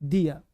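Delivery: low "दिया" with an open vowel ending; correct DIYA. Shown as a demonstration. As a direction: drop the r and fall on "दिया". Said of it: "इसका sound है सर". ("दिया", नीचे सुर में, र के बिना)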